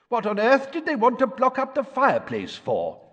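puzzled wizard father; Mr Weasley voice. Acted. Mr Weasley through wall.

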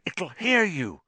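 high, urgent warning; frantic, strained plea. A narrator's reading. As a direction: warn them in a high panic. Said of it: "said Harry frantically".

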